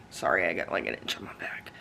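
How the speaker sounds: whispered aside, barely voiced; whispering. Real, not acted. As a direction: drop to a whisper mid-sentence. Aside about an itch.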